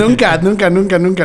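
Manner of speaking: laughing insistence. Real, not acted. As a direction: hammer the nevers while laughing. Admits never delivering a baby.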